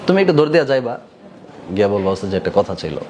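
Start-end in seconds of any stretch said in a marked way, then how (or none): 2.1-3.0 lower, softer respectful request; polite and soft. Shown as a demonstration. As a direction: speak up close, humbly and quietly. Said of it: "আদবের সাথে।". (আদবের সাথে আস্তে বলা)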